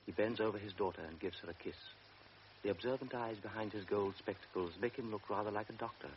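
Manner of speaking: normal talking voice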